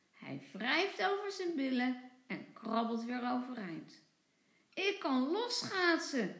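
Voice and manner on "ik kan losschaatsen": put-on higher, delighted child; proud little cheer of success; happy. A narrator's reading. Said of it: "zegt hij blij". (blij en trots)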